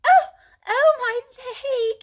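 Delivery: high, loud yelp of pain; pained cry. A narrator's reading. Mole cries out to Rat after tripping. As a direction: cry out at a hurt leg. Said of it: "he cried".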